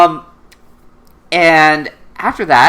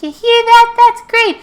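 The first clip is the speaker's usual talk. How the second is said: put-on very high falsetto girl voice; imitated sweet girl; 5 dB louder than usual. Nunnally hearing Suzaku's safe, relieved falsetto imitation. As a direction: gush with relief in a put-on girlish pitch. Same acted girl's voice.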